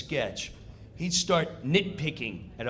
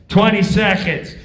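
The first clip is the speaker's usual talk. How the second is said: countdown call; 3 semitones higher, 11 dB louder than usual. Retold or imitated.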